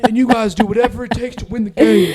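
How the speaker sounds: high, loud fired-up coach yell; rally the team like a coach; coach pep talk. Retold or imitated.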